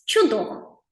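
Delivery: ordinary talk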